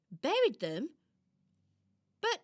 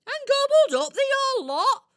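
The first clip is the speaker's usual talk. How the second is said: higher and louder indignant cry; outraged burst; 11 semitones higher, 10 dB louder than usual. Acted. Squirrel picturing greedy thieves, with loud outrage. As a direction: spit it out in comic outrage. Squirrel tells of stolen food.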